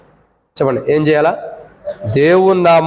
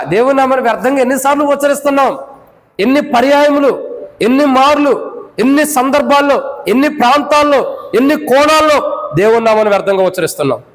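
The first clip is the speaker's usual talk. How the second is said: fervent preaching; 8 semitones higher than usual. Acted. Pastor warning the congregation about vain words, in fervent high preaching. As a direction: preach it hard, rising and driving.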